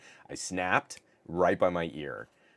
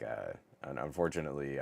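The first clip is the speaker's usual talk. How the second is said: normal talking voice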